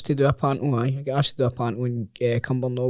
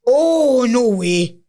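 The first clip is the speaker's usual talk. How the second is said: high, loud gasp of disbelief; real shock at the punchline; 11 semitones higher, 5 dB louder, much slower than usual. Real, not acted. Friend hearing the golf story punchline, shocked high cry. Reacting to "that's my wife" punchline.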